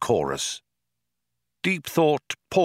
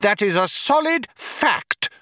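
bawling insistence. A narrator's reading. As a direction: hammer out each point in a high bawl. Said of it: "bawled Vroom Fondle, banging on a nearby desk".

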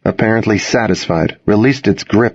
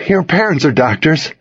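higher, pleading insistence; distressed urgency; 7 semitones higher than usual. A narrator's reading. Owner to friend over the wounded dog, pleading. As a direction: plead for their help.

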